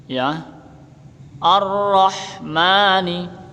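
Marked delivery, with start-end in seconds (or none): 1.4-3.4 Ar-Rahmani with a doubled rolled r, no al; slow Ar-Rahmani, lean on the rr; assimilated r. Shown as a demonstration. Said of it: "Ini enggak dibaca al".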